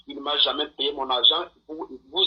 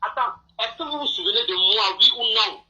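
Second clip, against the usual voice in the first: pitched up and insistent, heated; agitated high shouting; 7 semitones higher than usual. Real, not acted. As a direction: demand an answer, worked up. Caller confronts host on air.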